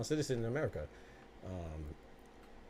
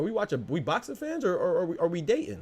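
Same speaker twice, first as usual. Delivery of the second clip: incredulous mockery; 6 semitones higher than usual. Mocking boxing fans who protect their favorite fighter, incredulous. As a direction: scoff like the question is absurd.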